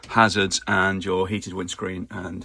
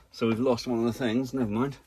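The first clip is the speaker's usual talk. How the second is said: normal talking voice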